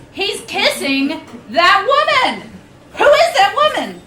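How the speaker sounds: high, loud, climbing to a near-shriek; rising indignant shock with a laugh; outraged disbelief. Acted. Watching her date kiss another woman, shrill shocked outrage.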